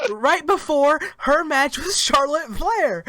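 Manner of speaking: high-pitched, loud disbelief; exasperated rant. Real, not acted. Co-host fed up with a repeated promo, exasperated. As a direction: push it up high, baffled and worked up.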